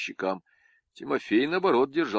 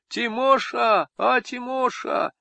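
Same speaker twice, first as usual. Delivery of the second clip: much higher, slow drawn-out calls; high sing-song calling; calling peasant woman; 14 semitones higher, 4 dB louder, slower than usual. Acted. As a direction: call to him like a pining village wife. Peasant woman calls her man.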